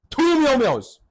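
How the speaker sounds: high, loud mock outrage; exasperated. Storyteller appalled by a second identical store, exasperated. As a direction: cry it out in disbelief, TWO stretched high.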